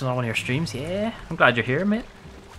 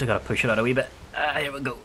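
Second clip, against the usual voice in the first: usual speaking voice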